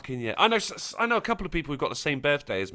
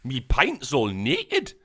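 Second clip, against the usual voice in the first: put-on Geordie accent; 2 semitones lower, slower than usual. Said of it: "anyone from Newcastle? They're like".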